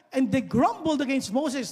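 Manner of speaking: slow, weighty reading; scripture reading. Acted. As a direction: lean on grumbled as you read. Reads scripture verse.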